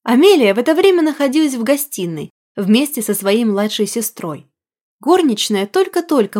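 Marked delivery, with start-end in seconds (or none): none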